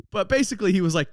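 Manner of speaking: normal talking voice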